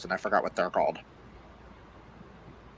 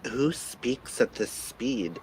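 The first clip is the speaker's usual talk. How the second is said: very slow, softer incredulous question; mock-slow; 6 dB quieter, much slower than usual. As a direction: stretch each word in mock disbelief at the slowness. Mocking slow audiobook playback speed.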